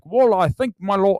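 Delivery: hammed Last Duel accent; Adam Driver accent. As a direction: put on a lordly costume-drama accent. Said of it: "The Last Duel, same thing".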